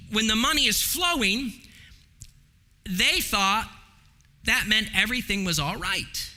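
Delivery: usual speaking voice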